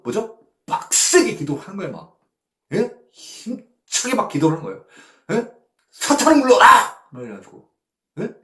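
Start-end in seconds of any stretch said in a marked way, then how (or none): 5.9-7.1 loud, high commanding cry; a shouted mock prayer against Satan; fervent rebuke. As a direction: drive off evil with your voice.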